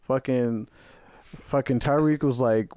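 usual speaking voice

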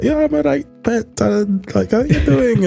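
high-pitched Scottish wife; put-on falsetto Scottish voice; Scottish accent. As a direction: imitate a DM's Scottish wife role. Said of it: "she's got a Scottish accent".

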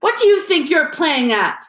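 high, loud angry question; shouted. A narrator's reading. Justin shouts angrily at Harry, high and loud. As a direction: shout at him in anger. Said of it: "he shouted".

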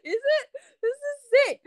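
put-on high, thrilled teen voice rising on each line; excited discovery. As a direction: gasp it out like a kid hearing rock for the first time. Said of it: "And I'm like".

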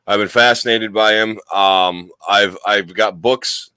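usual speaking voice

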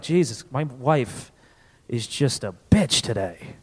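low, slow, fed-up complaint; grumbling husband. Acted. A fed-up husband grumbling at the town meeting, low and slow. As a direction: grumble about the wife.

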